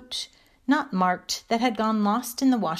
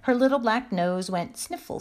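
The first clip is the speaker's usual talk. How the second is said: plain reading voice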